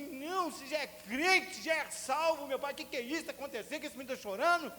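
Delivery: high, loud fretting mother; worried mother. Retold or imitated. Mother calling to the father, high and anxious. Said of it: "e minha mãe".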